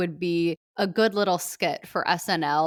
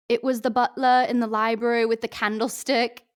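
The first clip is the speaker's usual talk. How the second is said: put-on a light Australian accent, playful; 5 semitones higher, 4 dB louder than usual. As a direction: Fat Amy, hammy Australian accent. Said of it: "my first crack at an Australian accent".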